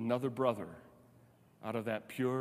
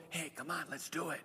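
much softer, a sly conspiratorial nudge; hushed, scheming whisper-like aside; subtle sly murmur; 3 semitones higher, 10 dB quieter than usual. Pretend. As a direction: slip it in softly like a secret pitch. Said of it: "that small little subtle".

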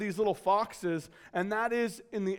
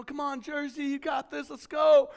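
higher-pitched imitation of a sports fan; sideline cheering; 7 semitones higher, 4 dB louder than usual. Uncle cheering at niece's soccer game, high and keen. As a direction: re-enact urging a player on. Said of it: "I was, you know, into the game".

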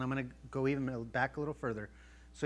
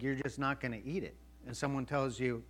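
ordinary talk, nothing marked

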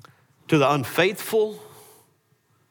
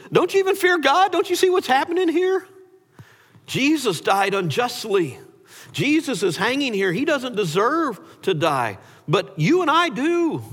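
urgent rebuke; 11 semitones higher than usual. Retold or imitated. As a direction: cry out at him, pitch way up. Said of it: "rebuking him".

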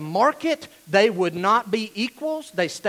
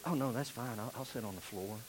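much softer, lower, trailing off; meek poor man; 10 semitones lower, 16 dB quieter than usual. Retold or imitated. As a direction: give in humbly, almost inaudible. Poor man accepting floor.

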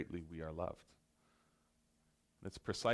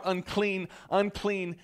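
higher, louder mock warning cry; imitated shout; 10 semitones higher, 9 dB louder, slower than usual. Preacher acting the leper's warning cry, hammed-up shout. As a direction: shout it as the outcast would.

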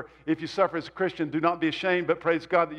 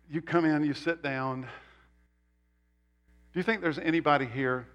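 ordinary delivery

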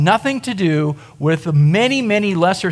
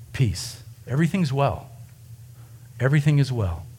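soft and low, comforting; calm frightened friends, quietly; gentle reassurance; 6 semitones lower, 7 dB quieter than usual. Pretend. Jesus comforting his frightened disciples, soft and low.

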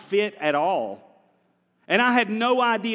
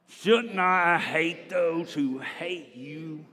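very slow, drawn-out; much slower than usual. Shown as a demonstration. Preacher showing the congregation the right way, very slow. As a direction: stretch every word slowly. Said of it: "You're not saying it right".

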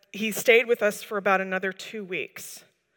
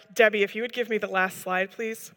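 ordinary talk, nothing marked

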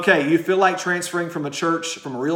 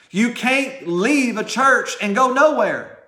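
slow, high, drawn-out preacher's warning; forceful preaching; 8 semitones higher, 5 dB louder, much slower than usual. Real, not acted. Preacher warning members thinking of leaving, slow and forceful. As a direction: stretch it out and drive it home.

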